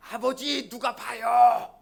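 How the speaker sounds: high, loud drawn-out shout; sudden shout. Acted. Son warns father in the radish field, sudden shout. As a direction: call out loudly, stretching the last word. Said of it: "갑자기 아들이 소리를 질렀습니다".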